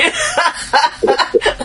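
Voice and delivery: high, delighted laughter; amused high-pitched laugh. Real, not acted. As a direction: burst out laughing at a clever line. Amused by the guest's clever line.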